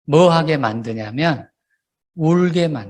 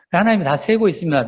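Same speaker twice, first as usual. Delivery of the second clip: ordinary delivery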